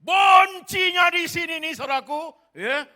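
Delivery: slow, booming shout on bencinya; preaching shout. Real, not acted. Preacher shouting to his congregation, slow and booming. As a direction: thunder it out to the congregation, slowly. Preacher driving the point home.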